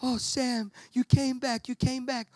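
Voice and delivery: a hint of tearful relief; relieved Frodo. Frodo thanking Sam for coming back, lightly voiced relief.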